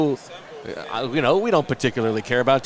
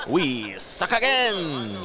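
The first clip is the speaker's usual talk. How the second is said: slow, high mock Waterboy line; Waterboy quote; 4 semitones higher, much slower than usual. Reading a fan's Waterboy quote, slow and high. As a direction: drawl it out like the Waterboy. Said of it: "he's inserting a Waterboy quote".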